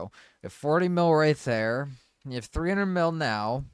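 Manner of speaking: loud, high gloating; excited taunting. Real, not acted. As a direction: rub it in loudly. Taunting broke teammate.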